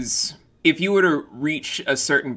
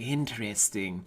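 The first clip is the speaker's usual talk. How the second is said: quiet intrigued; 4 semitones lower, 10 dB quieter than usual. Retold or imitated. Imitating listeners taking notes.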